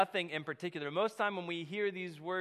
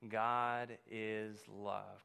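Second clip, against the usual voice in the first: drawn-out, spoken together with the church; slow unison; 7 semitones lower, 5 dB quieter, much slower than usual. Shown as a demonstration. Preacher leading the congregation in saying it slowly together. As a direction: say it slowly with everyone. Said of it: "Will you say that with me?".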